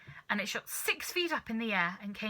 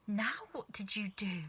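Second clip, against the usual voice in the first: whisper; 6 dB quieter than usual. Acted. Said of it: "cried Mister Cranky".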